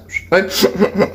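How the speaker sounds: high vocal tic into a run of hiccup noises; sudden high yelp, then stuttered hiccups; involuntary hiccup tic noises. Real, not acted. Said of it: "make that noise sometimes".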